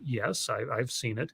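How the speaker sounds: quiet sheepish clerk; meek reply. Retold or imitated. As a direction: mumble it, eyes down. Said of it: "I kept my comments. I looked down".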